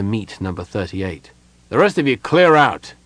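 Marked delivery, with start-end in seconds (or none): 1.7-2.9 louder, higher order to a crowd; commanding shout. A narrator's reading. Gerard clearing away the other kender, commanding shout. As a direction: shoo them off with a raised voice.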